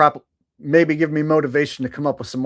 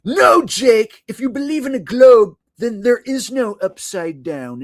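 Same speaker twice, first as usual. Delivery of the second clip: loud, higher whiny mock voice; mocking globe believer; 7 semitones higher, 6 dB louder than usual. Streamer imitating a globe believer scolding him in a mocking voice. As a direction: shout like a smug globe believer correcting him.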